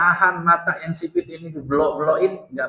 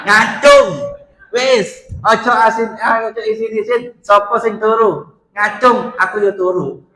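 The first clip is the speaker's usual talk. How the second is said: calling out; 4 semitones higher, 7 dB louder than usual. Real, not acted. Calling out to congregation.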